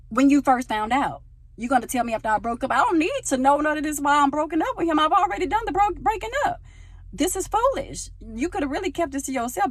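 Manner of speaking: high, loud, exasperated rant; acted-out outraged telling-off; indignant venting. Venting at friends who told late.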